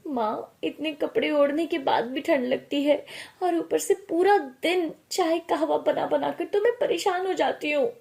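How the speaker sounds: high-pitched grumbling to mum; complaining daughter. Acted. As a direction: whine about it like a worn-out daughter.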